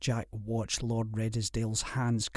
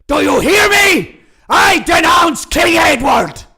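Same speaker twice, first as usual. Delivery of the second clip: much higher, much louder bellow; 17 dB louder than usual. A narrator's reading. Lord bellowing treason at his enemy. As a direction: bellow it straight into his face. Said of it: "bellowed in his face".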